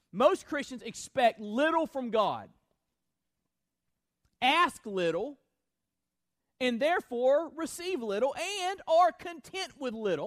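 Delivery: loud, high, building quote read; preaching at full force. Acted. Preacher reading A W Pink to the church, forceful. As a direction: drive each little home harder.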